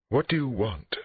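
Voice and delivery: high, indignant demand; frightened and angry woman demanding answers. A narrator's reading. Therapist to police at her door, demanding. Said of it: "she demanded".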